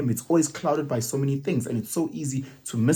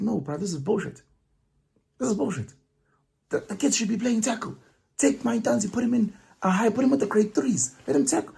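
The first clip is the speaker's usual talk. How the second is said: mock outburst, raised pitch without shouting; retold angry dad; 9 semitones higher than usual. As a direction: re-enact the outraged sideline dad. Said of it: "I find myself getting angry and shouting".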